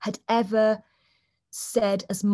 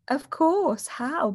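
eager offer; 7 semitones higher, much slower than usual. Retold or imitated. Eager partner stepping in.

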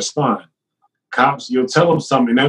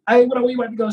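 calling across street; 11 semitones higher, faster than usual. Retold or imitated. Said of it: "I yell something".

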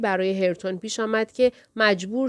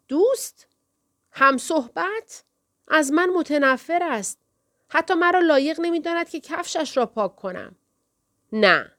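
indignant shout, much higher; 7 semitones higher than usual. A narrator's reading. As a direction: cry out in bitter disbelief. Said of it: "داد زد". (فریاد خشمگین و ناباور با صدای بسیار زیر)

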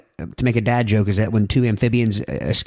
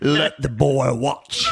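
Will Ferrell impression; much slower than usual. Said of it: "Will Ferrell plays that guy".

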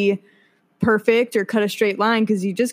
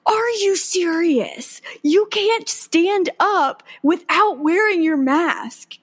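higher, drawn-out indignant reprimand; freaked-out scolding; 8 semitones higher, slower than usual. Retold or imitated. Bartender telling off a customer without a mask, freaked out. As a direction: imitate a bartender scolding, pitched up and incredulous. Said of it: "Just totally freaked out".

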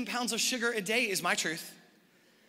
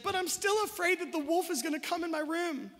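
put-on little girl voice; 7 semitones higher than usual. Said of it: "then she said".

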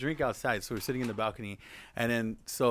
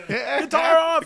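high, drawn-out mock battle cry; laughing, over-the-top yell; playful shout; 7 dB louder, much slower than usual. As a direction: holler it loud and long through laughter. Mock announcing a guitar contest.

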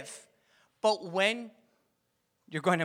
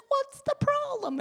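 much higher sweet voice, imitating wife; 4 dB louder than usual. Imitating Des asking sweetly, much higher pitched. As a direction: mimic her sweet innocent question up high. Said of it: "she's so sweet".

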